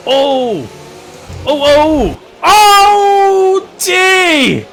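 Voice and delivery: rising gasps of shock into a yell; surprised shout. Real, not acted. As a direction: react to a sudden crash. Crash seen live.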